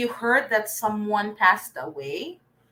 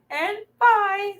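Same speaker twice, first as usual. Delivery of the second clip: and buy, pitched much higher with a bright rise; lifted high on the last buy; 11 semitones higher than usual. Shown as a demonstration. Teacher showing the last of three homophones, high and bright. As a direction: lift the final buy well up as if it ends a list. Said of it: "sets of three words that are spelled differently".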